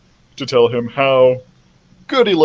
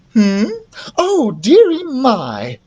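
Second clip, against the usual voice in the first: high, drawn-out dismay; flustered queen; 10 semitones higher, slower than usual. Acted. Queen realises she is smothering Carlos, flustered. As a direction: exclaim slowly in a high queen voice.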